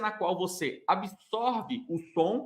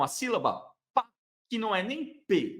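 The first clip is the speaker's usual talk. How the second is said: ordinary delivery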